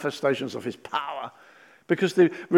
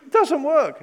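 mock frustrated outburst; 10 semitones higher, 7 dB louder than usual. Frustrated parent voiced.

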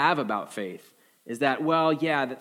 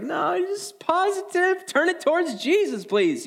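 much higher, slow mock-pious plea; prim churchgoer; 3 dB louder, slower than usual. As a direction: play the prissy believer who wants only positivity. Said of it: "some of you who's like".

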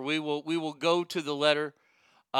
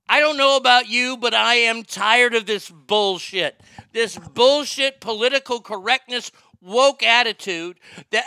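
high, loud exasperated rant; angry rant; 6 semitones higher, 5 dB louder than usual. Real, not acted. Streamer rages about political correctness. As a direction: vent the fury. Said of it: "hateful fucking rant".

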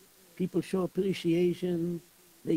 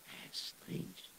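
breathy whispered aside; 14 dB quieter than usual. Acted. Shamas whispering about the strange death, hushed. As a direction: whisper it as a secret.

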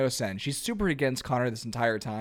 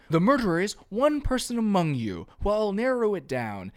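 raised pitch, grand detective announcement; Sherlock detective voice; 6 semitones higher than usual. Retold or imitated. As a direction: play the detective revealing the culprit. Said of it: "a classic Sherlock Holmes moment".

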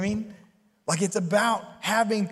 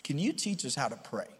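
lower, softer simple request; humble asking; 8 semitones lower, 10 dB quieter than usual. Retold or imitated. Disciples humbly asking Jesus to teach prayer. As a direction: ask humbly and gently. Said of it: "they said".

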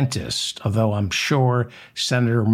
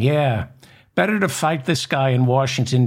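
ordinary talk, nothing marked

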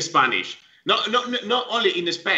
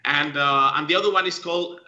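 normal talking voice

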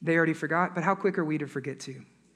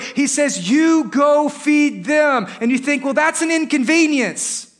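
loud, driving preacher delivery with punched words; preaching at full force; 8 semitones higher, 6 dB louder than usual. Acted. Pastor urging his church to reach people themselves, forceful preaching. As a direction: build the exhortation phrase by phrase.

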